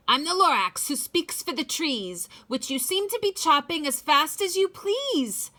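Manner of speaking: cross little Lorax lecturing the Onceler; snappy Lorax. Acted. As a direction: tell him off in the Lorax's voice. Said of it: "He snapped".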